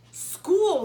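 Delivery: high, loud dismayed cry of school; shocked echo. Acted. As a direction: gasp the word school in horror. Lucy echoes in dismay.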